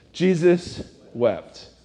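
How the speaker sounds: slow, weighty, each word held apart; dramatic verse reading. Shown as a demonstration. Preacher reciting the famous verse to the congregation, slow and weighty. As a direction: let the famous verse land slowly.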